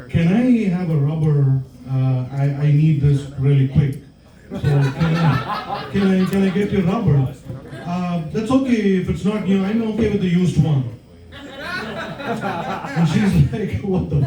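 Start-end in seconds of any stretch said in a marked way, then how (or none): none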